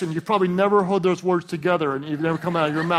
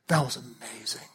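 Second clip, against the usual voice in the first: hushed, lower imitation of private praise; admiring whisper; 5 semitones lower, 5 dB quieter than usual. Wife to preacher husband after the sermon, whispering. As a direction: lean in and whisper it like a secret compliment.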